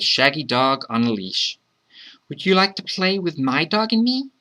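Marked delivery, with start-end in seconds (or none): 2.3-4.3 put-on high-pitched child asking eagerly to play; high little-kid question; child's voice. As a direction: ask in a high child's voice, lifting at the end. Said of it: "the child asked".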